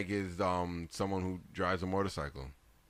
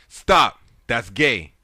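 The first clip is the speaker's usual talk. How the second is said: loud, high shouted command; Kevin Hart; 4 semitones higher, 17 dB louder, much slower than usual. Retold or imitated. Quoting a comedian's punchline to co-hosts, shouted. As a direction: yell it out as a punchline. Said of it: "in my voice".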